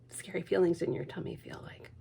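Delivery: gentle whisper; soft and hushed. A narrator's reading. Reader comforting the child in a whisper.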